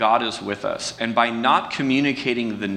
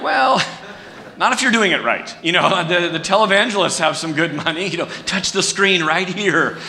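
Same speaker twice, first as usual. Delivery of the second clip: amused retort; 8 semitones higher, 4 dB louder than usual. Retold or imitated. Laughing retort to coworker.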